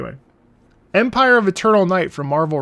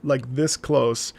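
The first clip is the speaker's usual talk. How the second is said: ordinary delivery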